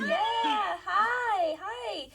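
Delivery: high, excited hellos; delighted greeting. Real, not acted. Author saying hello to a stunned fan, delighted. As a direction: greet her high and giddy.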